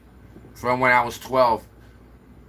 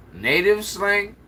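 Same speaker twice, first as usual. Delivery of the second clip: higher, slow disbelieving echo of "Native slang?"; incredulous repeat; 6 semitones higher, slower than usual. Real, not acted. Reading a viewer's comment, then repeating it incredulously.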